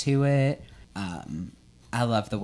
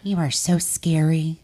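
spooky haunt voice; 7 semitones higher, 6 dB louder than usual. Pretend. Playful scary voice at friend.

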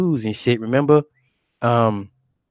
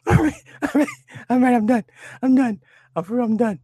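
high, squeaky voice choked with laughter; helpless laughter; 12 semitones higher than usual. Real, not acted. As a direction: try to speak through real giggles. Recovering from laughing fit at own impression.

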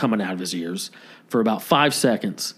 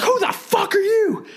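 high, hammed-up screeching neighbor; screaming neighbor. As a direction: shriek it like an outraged guy. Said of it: "Just screaming".

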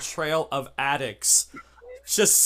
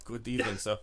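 lightly voiced driver, low and soft; a soft, low, deferential reply; hesitant driver; 5 semitones lower, 9 dB quieter than usual. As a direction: answer quietly as the hired driver. Game master answers as the driver.

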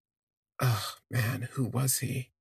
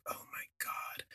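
whispered, smitten gasp of words; hushed, breath-only swoon; awestruck whisper; 13 dB quieter than usual. Retold or imitated. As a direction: whisper it to yourself, floored. Retold stunned inner reaction.

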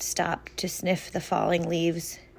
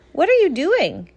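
much higher and louder squeaky chipmunk; curious chipmunk; 10 semitones higher, 7 dB louder than usual. Acted. Country Chipmunk meeting City Dog, high curious chipmunk. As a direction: pipe it up like a bright little chipmunk. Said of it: "asked Country Chipmunk".

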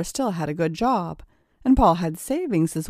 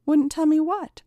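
curious; 10 semitones higher than usual. Acted. Sandra walks in curious.

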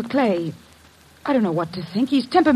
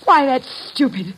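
ordinary talk, nothing marked

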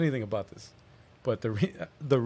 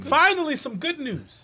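excited relief; 12 semitones higher, slower than usual. Real, not acted.

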